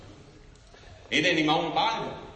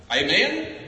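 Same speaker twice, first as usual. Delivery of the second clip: ordinary delivery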